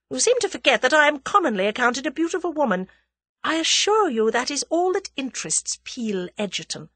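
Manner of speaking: high, haughty lady's voice; disdainful, self-assured reassurance; scornful. A narrator's reading. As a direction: put him down with lofty scorn. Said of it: "eyed him scornfully".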